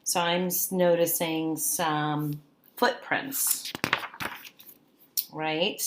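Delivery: ordinary talk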